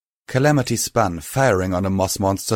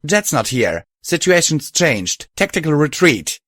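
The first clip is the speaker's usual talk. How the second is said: higher, loud commanding shout; 7 semitones higher, 4 dB louder than usual. A narrator's reading. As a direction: bark the retreat order. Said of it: "mare shouted".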